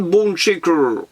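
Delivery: drawn-out English name with a French accent; Franglish accent. Shown as a demonstration. Vlogger showing off a toy car name, in Franglish accent. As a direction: lean into a playful Franglish accent. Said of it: "Mon accent franglish".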